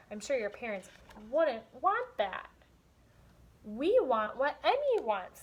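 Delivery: lively storybook reading with voiced characters; story read aloud. Teacher reading a picture book to young students, animated. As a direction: read it to the class with expression.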